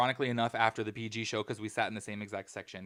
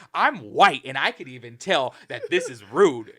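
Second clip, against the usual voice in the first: said loudly so they overhear; loud pointed remark; 9 dB louder than usual. Retold or imitated. Lady calling out the rude couple, loud and pointed. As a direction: make sure the rude couple hears it. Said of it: "she said it so that way they could hear".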